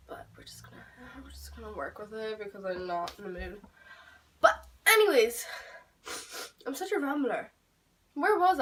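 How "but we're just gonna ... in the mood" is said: breathy whispered aside, trailing off; muttered confession in a whisper. Real, not acted. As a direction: let it slip out as a whisper. Aside about her makeup.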